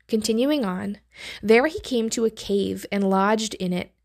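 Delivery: ordinary talk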